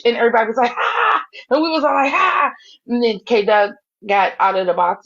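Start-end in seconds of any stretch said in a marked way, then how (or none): 0.7-1.2 high, sharp imitated gasp; mock shocked gasp. Imitates everybody's shocked reaction. 2.1-2.5 high, sharp imitated gasp; mock shocked gasp. Imitates group's shocked reaction.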